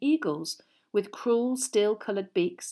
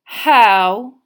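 cold drawl; 3 semitones higher, 15 dB louder than usual. A narrator's reading. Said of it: "cold, drawling voice".

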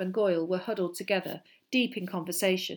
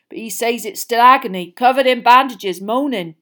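lightly voiced, louder big man; dejected report in a raised voice; Hagrid, dull; 5 semitones higher, 13 dB louder than usual. A narrator's reading. Gamekeeper describes the pupil's agony, dejected. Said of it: "said Hagrid dully".

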